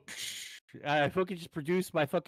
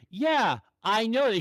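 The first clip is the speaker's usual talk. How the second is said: imitated short, louder retort; little attitude; 5 semitones higher, 4 dB louder than usual. Drive-thru girl to annoyed customer, a little attitude. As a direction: mimic her with a touch of 'tude. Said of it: "She gave me a little toot".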